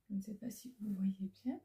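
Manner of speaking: soft breathy aside to the camera; whisper. Real, not acted. Vlogger whispering to viewers while holding the calendar. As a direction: drop to a whisper mid-unboxing.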